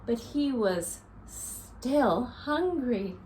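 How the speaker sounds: lively storybook read-aloud for little ones; reading to children. A narrator's reading. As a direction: read it to a circle of kids, counting along. Reads picture book to children.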